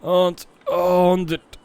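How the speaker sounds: slow, high, strained count; doing sit ups. Real, not acted. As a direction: drag out the final rep. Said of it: "ich mach auch noch schnell ein paar Sit-Ups".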